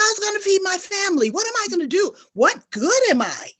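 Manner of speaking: high, fast panicked questions; retelling past panic, high and rushed. Retold or imitated. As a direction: rattle off the worries in a rising panic. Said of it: "I was like".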